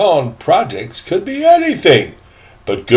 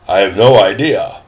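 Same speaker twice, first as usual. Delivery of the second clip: lower, gruffer try at the line; lower trial voice; 6 semitones lower than usual. Acted. Teacher testing a lower voice for the line. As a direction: drop the voice for a first attempt. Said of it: "I gotta get the voices right".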